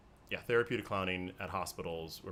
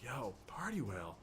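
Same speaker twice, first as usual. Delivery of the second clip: cool bartender; 6 semitones higher, 6 dB quieter than usual. Acted. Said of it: "he's just like".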